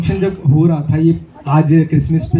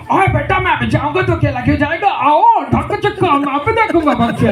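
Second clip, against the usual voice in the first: cartoon character voice; 8 semitones higher than usual. Comedian voicing a cartoon brother, high and squeaky. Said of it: "वो कह रहे थे".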